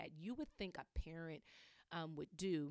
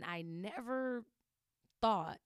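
slow, high, louder on never, then a big push on thought; stressed never; 4 semitones higher, 7 dB louder, slower than usual. Real, not acted. Telling the audience, slow heavy stress on never. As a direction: press hard on never and thought with disbelief. Stressing disbelief at her breakdown.

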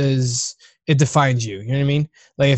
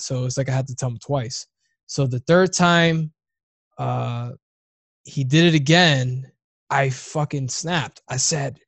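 ordinary talk, nothing marked

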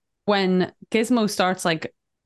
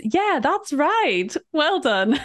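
slow, high, patronizing praise; sarcastic praise; 6 semitones higher, slower than usual. Retold or imitated. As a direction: drawl it like praising a toddler. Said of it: "my favorite thing to reply now is just".

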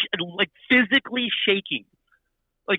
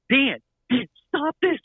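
retold scream; 8 semitones higher, slower than usual. Stage manager screaming in his ear to stop this, high-pitched mock scream. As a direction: imitate a frantic scream into an earpiece. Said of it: "she's screaming in my ear".

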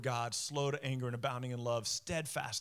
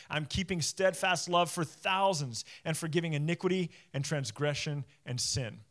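ordinary talk, nothing marked

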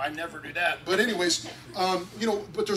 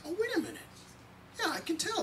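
dawning realization; 12 semitones higher, 6 dB quieter, much faster than usual. Retold or imitated. Father retells sudden realization.

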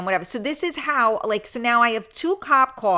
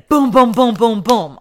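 loud booms imitating a knock; mock pounding; 10 dB louder than usual. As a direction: bang out each boom loudly. Retelling loud knocking on door.

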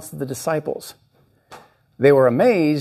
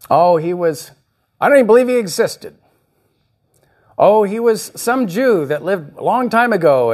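mock casual voices, higher and louder; people on the street; 5 semitones higher, 4 dB louder than usual. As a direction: toss off dismissive street opinions. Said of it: "ask people on the street".

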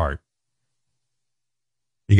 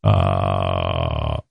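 lower, slow creaky fry; vocal fry; much slower than usual. Shown as a demonstration. Explaining how people talk, in slow creaky fry. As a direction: let the voice crackle down into fry. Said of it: "talk like this".

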